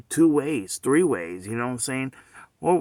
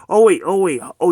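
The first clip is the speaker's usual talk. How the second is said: loud, higher burst of "oh, wait"; sudden realisation; 5 semitones higher, 9 dB louder than usual. Real, not acted. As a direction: catch yourself as it dawns on you. Sudden realisation mid-rant.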